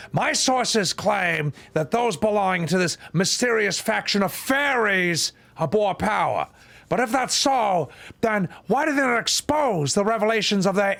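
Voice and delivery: hammed-up Al Pacino yelling at the fairies. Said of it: "I can always do a bit of Al Pacino".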